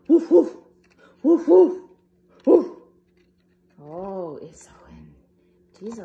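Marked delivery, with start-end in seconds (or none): 0.1-2.7 loud barking dog; loud woofs. Acted. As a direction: bark like a sheepdog.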